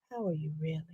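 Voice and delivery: soft, hushed interviewer coaxing a guest; a quiet probing talk-show host voice; Barbara Walters. Retold or imitated. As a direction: lean in gently and ask softly. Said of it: "and she would do the same thing".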